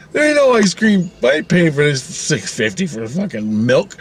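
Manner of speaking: acted-out angry customer; indignant protest. Acted quarrel over melted ice cream.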